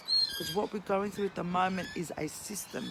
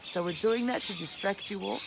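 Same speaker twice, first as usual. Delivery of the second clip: ordinary talk, nothing marked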